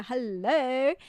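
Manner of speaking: ordinary talk, nothing marked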